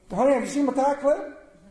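high, loud call for honey; calling to wife. Retold or imitated. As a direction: call out to the wife, a little pleading. Same call repeated.

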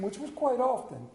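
ordinary delivery

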